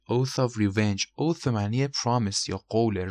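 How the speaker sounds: ordinary talk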